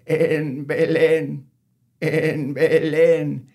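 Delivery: slow, quavering high bleat; goat bleat. Acted. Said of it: "the goat bleated".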